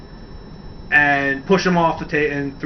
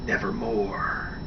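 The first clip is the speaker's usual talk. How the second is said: low, slow, soft brooding Nevermore; Raven's catchphrase; 7 semitones lower, 12 dB quieter, slower than usual. Retold or imitated. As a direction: intone it darkly and slowly like a gothic villain. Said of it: "He would just look at the camera and he'd be like".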